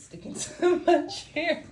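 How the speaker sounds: high, loud, laughing through the words; laughing confession. Real, not acted. Reader confessing a short-dress mishap to viewers, laughing hard. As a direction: crack up mid-sentence, voice shooting up.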